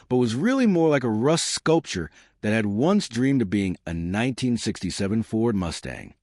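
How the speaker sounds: usual speaking voice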